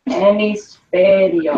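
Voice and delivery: "hemisferio" stretched out sound by sound; slow sounding-out. Shown as a demonstration. Teacher shows class they forgot a letter, slowly sounding out. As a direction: sound the word out very slowly for spelling.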